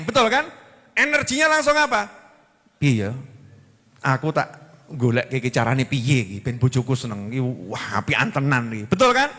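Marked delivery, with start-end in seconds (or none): 2.8-8.9 much lower, pleased murmured wife; musing wife. Retold or imitated. Wife thinking how to please her husband, low and pleased. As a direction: think aloud, happily planning for him.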